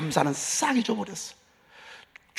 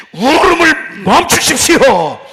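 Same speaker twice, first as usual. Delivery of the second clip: slow, loud command; commanding shout; 5 semitones higher, 9 dB louder, slower than usual. Real, not acted. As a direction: order the weeping to stop. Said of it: "이런 울음이 온다면".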